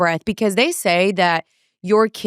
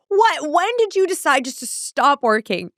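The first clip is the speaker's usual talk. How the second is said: exasperated disbelief; 6 semitones higher than usual. Retold or imitated. GPS suddenly stops, exasperated outburst.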